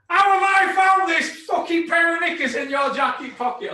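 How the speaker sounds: loud, high accusing yell; retold furious shout. Wife yelling at her husband over knickers in his pocket, furious shout. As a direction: bellow it like a wife who's just found the evidence. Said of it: "She goes".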